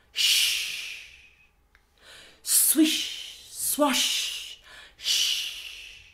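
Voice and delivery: long hissing swish, swash, shh; rustling grass noises. Acted. Hippo moving through the tall grass, drawn-out rustling sounds. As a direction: draw out each sh like rustling grass.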